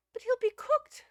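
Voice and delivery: put-on very high, loud young lady's exclamation; alarmed cry. A narrator's reading. Phyllis exclaims over the cat up the chimney, alarmed. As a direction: cry out in sudden dismay, pitched right up. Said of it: "cried Phyllis".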